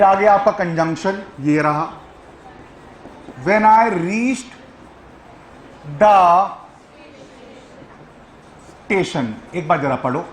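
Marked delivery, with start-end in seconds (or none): 3.5-9.3 very slow, with long gaps between words; drawn-out, halting word-by-word delivery; slow dictation. Real, not acted. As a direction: dictate it while writing it out. Writing the sentence out while saying it.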